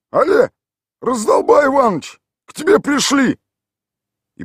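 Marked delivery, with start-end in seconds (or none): 0.1-3.4 loud, high calling through the door; bellowed command. A narrator's reading. Tolik calls Mikhail Ivanovich out of the house with a loud command. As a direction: shout into the house like a boss. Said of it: "скомандовал".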